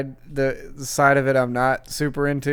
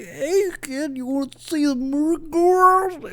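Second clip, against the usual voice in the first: Goofy voice; 14 semitones higher, slower than usual. Retold or imitated. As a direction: ham up a silly cartoon dog. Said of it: "he's like".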